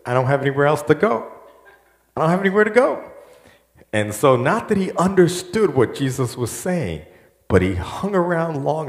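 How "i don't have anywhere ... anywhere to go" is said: rushed, much higher pleading voice as Peter. Retold or imitated. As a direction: blurt it out fast like a desperate disciple. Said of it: "And Peter says".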